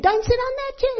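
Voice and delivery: put-on high, loud shrill child's yell; little girl screaming. Little girl yelling at the visiting guests, a high child's scream. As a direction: shriek it like a bossy little girl. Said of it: "the little girl screamed".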